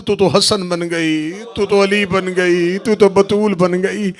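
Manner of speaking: ordinary delivery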